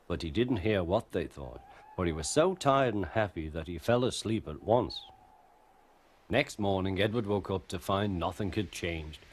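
ordinary delivery

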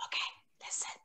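hushed whisper to the class. Real, not acted. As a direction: whisper so they lean in.

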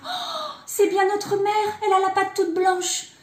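high gasp, then loud high exclamation; delighted outcry. A narrator's reading. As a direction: cry out, fully convinced, high and loud. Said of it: "et les biquets s'écrièrent".

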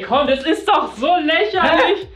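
high, laughing it's so ridiculous; laughing protest. Real, not acted.

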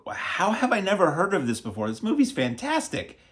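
high, wide-eyed disbelief at a hidden gem; amazed. Retold or imitated. Reviewer quoting his own reaction to the movie, amazed. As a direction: gush like you just discovered it. I'm like.

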